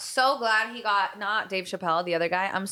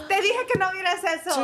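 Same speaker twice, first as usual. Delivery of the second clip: scolding mom voice; 8 semitones higher than usual. Retold or imitated. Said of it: "they were like".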